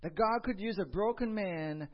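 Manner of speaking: rising, full-voiced sermon delivery; preaching. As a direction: preach it with conviction to the congregation.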